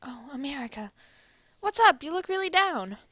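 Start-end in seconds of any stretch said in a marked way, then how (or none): 1.6-3.0 upbeat hero voice. Acted. Cheerful America character voice.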